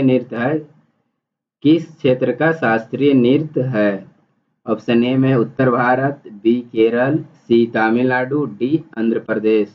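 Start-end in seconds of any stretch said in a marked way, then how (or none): none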